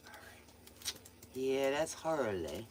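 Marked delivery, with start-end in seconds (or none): 0.1-0.9 whispering. Real, not acted. Whispering at night.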